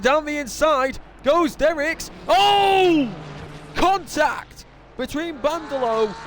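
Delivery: high, shouted race commentary; excited crash call. Real, not acted. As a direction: cry out as the cars collide.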